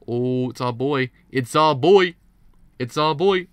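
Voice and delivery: loud, rising hype shout; excited cheer. Real, not acted. Fan hails a favourite character's entrance, excited shouting.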